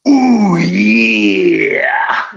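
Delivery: a hint of a gravelly wrestler growl; drawn-out wrestler ooh yeah; Macho Man impression. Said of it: "your best nineteen nineties wrestling impression".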